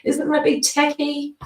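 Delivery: high, fast squeaky worried voice; panicky members. Retold or imitated. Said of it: "members have been freaking out, going".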